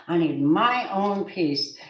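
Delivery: slow, drawn-out plea for peace; slow pleading. Retold or imitated. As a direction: stretch each word as a weary plea.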